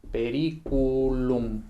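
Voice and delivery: "periculum" said very slowly, syllable by syllable; slow and clear. Shown as a demonstration. Teacher repeats Latin word for class, slow and clear. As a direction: spell out "periculum" slowly for students to copy. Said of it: "procede del latín periculum".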